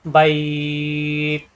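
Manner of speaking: বাইত drawn very slowly and softly, the আই held; বাইত recited softly with a held আই; soft one alif stretch. Shown as a demonstration. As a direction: lengthen বাইত gently before the stop. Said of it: "নরম করে এক আলিফ টানতে হবে".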